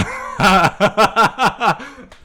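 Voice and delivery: high, loud burst of laughter; loud laughing fit. Real, not acted. Storyteller cracking up at his camp story, loud laughter. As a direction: crack up helplessly.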